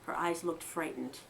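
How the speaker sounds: plain reading voice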